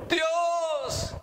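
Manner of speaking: high, long wail calling out; acted tearful cry. Preacher acts out Esau calling on God, tearful wail. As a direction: call out desperately, drawing out the word. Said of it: "aún con llanto".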